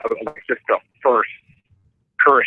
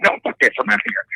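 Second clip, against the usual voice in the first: high snapping refusal; fed up, leaving; wife walking out, hammed-up anger; mock angry exit; 10 semitones higher, faster than usual. Wife quits in story.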